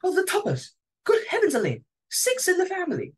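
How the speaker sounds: excited. Acted. Sudden idea, delighted relief.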